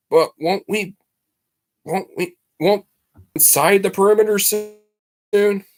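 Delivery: put-on a nervous teen stammering a protest. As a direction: stumble anxiously through the question, voice climbing. Nervous boy stammers his objection.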